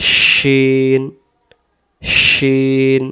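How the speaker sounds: "শিন" drawn out, opening on a broad SH; "শিন" with a long held vowel after sh; sh from mid-tongue. Shown as a demonstration. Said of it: "জিহ্বাটার মাঝখান থেকে ভেসে আসবে".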